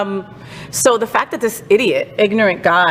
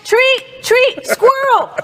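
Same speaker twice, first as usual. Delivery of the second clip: high doggy voice; much slower than usual. Pretend. Talks to the dog like an excited dog.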